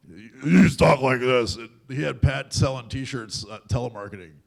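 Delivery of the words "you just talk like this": slow, louder, booming deep voice; slow booming deep friend's voice; deep-voiced friend. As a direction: rumble it out like a big-voiced buddy. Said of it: "his voice made mine sound like a chipmunk".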